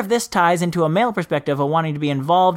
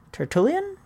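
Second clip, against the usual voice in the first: "Tertullian" higher, rising sharply at the end; questioning retry; 7 semitones higher, 4 dB quieter than usual. Shown as a demonstration. Host doubting an author's name, "Tertullian" high and questioning. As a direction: lift "Tertullian" into a quicker question.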